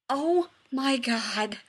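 loud, slow, drawn-out outcry; shouted. A narrator's reading. Rodney shocked at Shepard, loud shout. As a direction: shout it out in disbelief. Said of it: "Rodney shouted".

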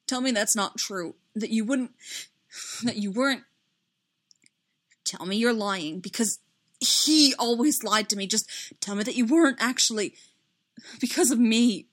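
plead, breaking off mid-thought; upset, urgent begging; distraught pleading. A narrator's reading. Pleading after sob, trembling.

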